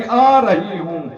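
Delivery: usual speaking voice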